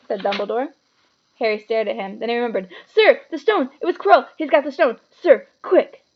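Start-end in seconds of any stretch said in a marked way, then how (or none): 2.9-6.0 loud urgent alarm; boy urging the headmaster in alarm. A narrator's reading. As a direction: blurt the warning in panic. Harry urgently wakes.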